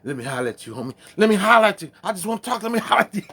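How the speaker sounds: high, loud hammed-up pleading; high loud mock pleading; mock Cedric voice. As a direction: impersonate a pushy guy begging to talk. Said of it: "Talk about".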